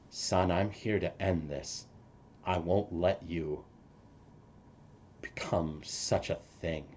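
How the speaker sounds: a father sadly vowing to stop his son; father to son, hushed grieving resolve; tearful resolve. Acted. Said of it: "with tears streaming down his face".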